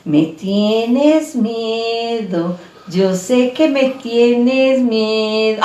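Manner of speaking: slow, mocking singsong ghost-girl taunt; sarcastic ghost girl. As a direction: draw it out like a teasing ghost child. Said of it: "se reía más sarcásticamente". (niña burlona canturreando despacio)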